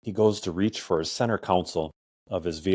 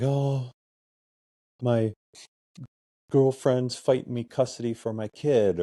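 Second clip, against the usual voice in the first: imitating a dejected man, higher-pitched; halting, higher retelling of someone's troubles; depressed, upset man; 6 semitones higher than usual. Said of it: "just depressed and crying".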